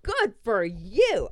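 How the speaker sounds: emphatic, stretched-out congratulation; impressed cheer. Retold or imitated. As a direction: draw it out as an approving cheer. Cheers friend for refusing to tip.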